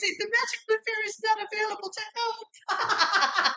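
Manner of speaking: very high squeaky voice breaking into laughter; high squeaky fairy. Acted. Imitating little sister refusing to help, squeaky fairy. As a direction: play a tiny fairy turning them down. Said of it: "the high squeaky voice would say".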